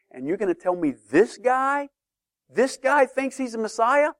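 scoffing question, pitch climbing high; mock incredulity. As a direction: act out scornful disbelief at an upstart. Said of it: "the people in Jerusalem saw it this way".

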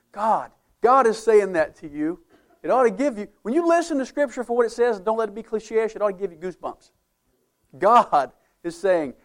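rising to full preaching force; fervent preaching. Real, not acted. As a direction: drive the point home with fervor. Preacher builds to emphatic point.

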